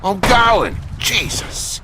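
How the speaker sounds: loud, exasperated yell; irritated shout. Acted. Shouting back at the people hurrying him, annoyed. Snapping at someone rushing him.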